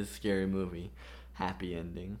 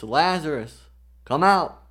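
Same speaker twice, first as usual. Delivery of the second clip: calling out loudly and high; 5 semitones higher, 10 dB louder than usual. Acted. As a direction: call him out of the tomb. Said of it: "Jesus called in a loud voice".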